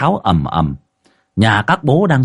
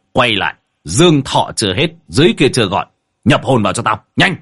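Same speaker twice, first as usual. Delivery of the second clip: brisk, mildly raised exorcist's order; barked command; 3 semitones higher than usual. A narrator's reading. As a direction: order a spirit back with some force. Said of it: "Cậu vừa quát".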